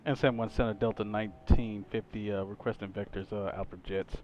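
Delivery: slow, low radio call to ATC; clipped cockpit radio phraseology; pilot over the radio. Acted. Streamer makes his radio call.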